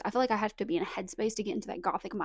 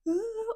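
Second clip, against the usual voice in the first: a sudden high gasping breath; sharp gasp; 11 semitones higher than usual. Retold or imitated. Recounting crying over the book ending, a sharp gasp. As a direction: gasp as the tears hit.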